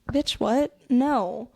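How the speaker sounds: ordinary talk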